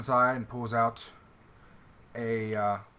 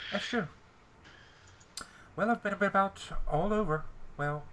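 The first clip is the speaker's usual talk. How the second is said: nasal voice; 9 semitones higher than usual. Acted. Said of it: "are you holding your nose?".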